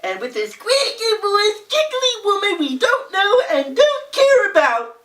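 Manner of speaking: high, giggly mock voice. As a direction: mimic a giggly woman, hammed up. Mocking the giggly woman.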